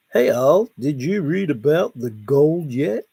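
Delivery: normal talking voice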